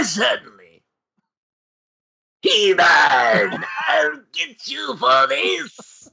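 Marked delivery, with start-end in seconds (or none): 2.4-6.1 shouted Skeletor villain line; sputtering foiled villain. Pretend. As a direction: yell it like a foiled cartoon villain. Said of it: "give us a little bit of that".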